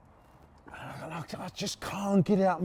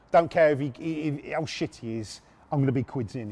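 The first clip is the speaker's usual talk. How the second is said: ordinary delivery, nothing marked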